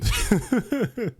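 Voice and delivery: high laugh. Real, not acted. Laugh after the story.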